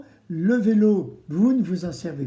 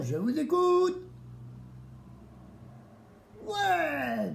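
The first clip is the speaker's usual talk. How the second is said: silly clown; 10 semitones higher, 6 dB quieter, slower than usual. Acted. Clown host playing with the kids.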